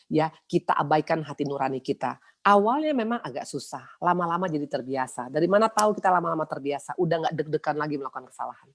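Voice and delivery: usual speaking voice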